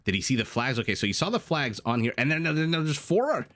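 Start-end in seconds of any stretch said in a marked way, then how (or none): 2.2-3.5 tripping over the words, higher pitched; real flustered stutter, pitch jumping up. Real, not acted. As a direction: stumble excitedly on the repeated word before getting it out. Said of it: "why I'm stuttering so much".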